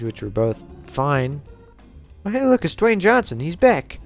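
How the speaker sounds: usual speaking voice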